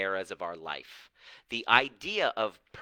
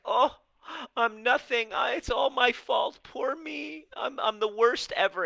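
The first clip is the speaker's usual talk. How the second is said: mock self-pity; 14 semitones higher, 5 dB louder than usual. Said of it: "Anytime you're like".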